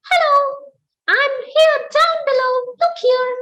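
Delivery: very high, squeaky little mouse voice; tiny mouse voice. Acted. Tiny mouse calling up to the big animals, high squeak. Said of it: "Even when he said".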